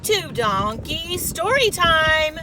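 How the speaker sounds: big excited announcement; excited call. Real, not acted. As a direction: shout it out like a cheery invitation. Calling kids to story time.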